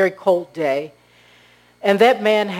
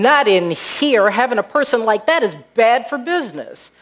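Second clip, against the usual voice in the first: louder, climbing acted objection; indignant refusal; 5 semitones higher, 5 dB louder than usual. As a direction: refuse firmly, stressing each phrase. Manager refuses the homeless man.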